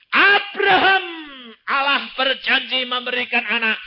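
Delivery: loud, high pulpit proclamation; fervent Indonesian sermon climax; preaching at full force. Acted. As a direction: declare it to the whole church.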